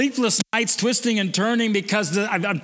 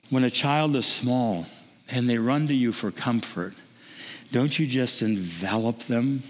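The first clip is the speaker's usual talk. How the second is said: tender hush; 8 semitones lower, 3 dB quieter than usual. Real, not acted. Preacher gently picturing a parent embracing a child, tender.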